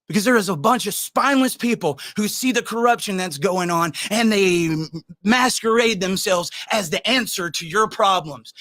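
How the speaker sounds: ordinary delivery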